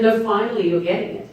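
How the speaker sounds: the director cheerfully telling her she's finally got it; director's reply. Retold or imitated. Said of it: "he said".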